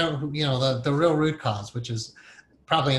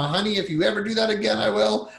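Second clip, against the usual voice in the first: high, fast scolding threat; angry venting at spouse, higher and quicker; mock threat to spouse; 7 semitones higher than usual. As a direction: snap a warning at your honey, pitched up.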